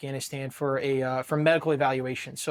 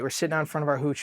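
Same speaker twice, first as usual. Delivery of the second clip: usual speaking voice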